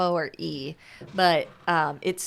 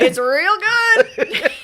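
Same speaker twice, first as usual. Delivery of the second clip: much higher, louder and very slow, laughing through it; old show catchphrase; 12 semitones higher, 10 dB louder, much slower than usual. Pretend. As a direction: stretch it out like the old show's rating voice. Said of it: "I immediately fell back into Bras and Books".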